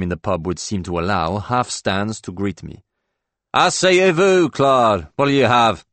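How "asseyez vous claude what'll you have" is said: loud, high, boozy welcome; drunk loud greeting. Acted. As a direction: greet him like a drunk host. Drunk colleague greets loudly.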